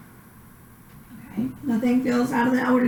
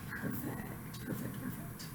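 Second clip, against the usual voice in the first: whispering; 22 dB quieter, slower than usual. Real, not acted. Praising patient during exam.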